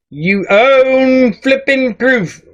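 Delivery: slow, high, drawn-out mock holler; mock yelling. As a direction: stretch each word as if reading all-caps. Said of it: "Why'd she gotta be yelling?".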